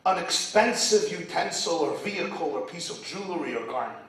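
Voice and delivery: normal talking voice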